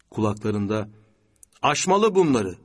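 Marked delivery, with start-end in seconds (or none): 1.6-2.5 raised, emphatic slogan; ringing remembered voice. A narrator's reading. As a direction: proclaim it in a higher pitch. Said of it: "çok tanıdık bir ses çınlıyordu".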